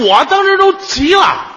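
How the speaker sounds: shouted high and loud; angry shout. Retold or imitated. Telling the audience how furious he got, shouting. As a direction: blurt it out fuming.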